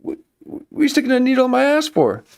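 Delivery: high, fast mock protest; hammed-up panic at the needle; outraged startled guy. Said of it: "they're like".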